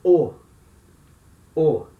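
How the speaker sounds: round, closed O like in amor; closed o sound. Shown as a demonstration. Teacher to class, demonstrating the closed Portuguese O. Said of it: "letter O can sound like this".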